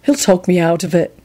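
subdued gloom; glum. A narrator's reading. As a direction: admit it glumly. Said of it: "Neville responded glumly".

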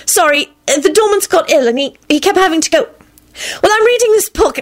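high-pitched, halting excuse; flustered stammering. A narrator's reading. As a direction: ramble apologetically in a high, flustered voice. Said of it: "she stammered out".

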